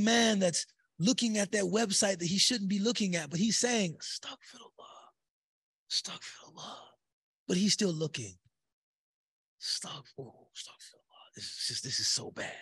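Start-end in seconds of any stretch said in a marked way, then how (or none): 4.0-6.8 breathless softer whisper; whispered astaghfirullah. Pretend. Young man at the website, whispering astaghfirullah. Sinner whispering repentance while looking. 9.6-12.5 breathless softer whisper; whispered astaghfirullah. Pretend. Young man at the website, whispering astaghfirullah.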